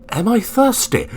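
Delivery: high-pitched, exclaiming echo of a question; emphatic echo question. Acted. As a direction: throw the question back with voice leaping up. Echoes the question with relish.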